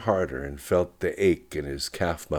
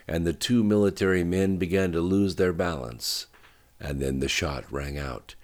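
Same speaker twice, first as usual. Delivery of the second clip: usual speaking voice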